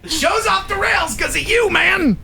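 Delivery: high-pitched, hammed-up complaint; mock outrage. Host playfully blames co-host for derailed show, mock outrage.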